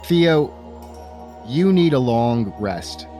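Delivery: normal talking voice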